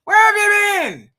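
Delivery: exasperated shout. Pretend. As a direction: yell it in disbelief. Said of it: "because I'm like".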